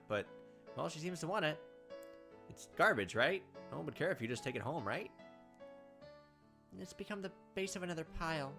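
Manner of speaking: ordinary delivery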